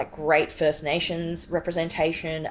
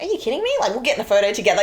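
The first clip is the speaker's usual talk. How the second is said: incredulous retort; 5 semitones higher, 5 dB louder, much faster than usual. Retold or imitated. Mocking the not that many claim.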